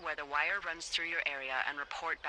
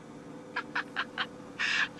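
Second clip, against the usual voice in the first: a real burst of laughter at the silly name. Real, not acted. Laughing at her friend's story about Royal Butts. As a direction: crack up at the name.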